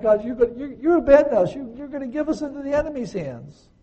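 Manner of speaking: high, loud and fast, tumbling accusation; desperate outburst. Acted. Joshua accusing God of abandoning them, high frantic shout. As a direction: rush the words like a panicked leader. Preacher voices Joshua's despair.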